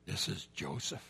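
very low hushed voice; a frightened murmur; dread whisper. Acted. Brothers realising who he is, hushed dread.

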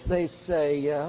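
normal talking voice

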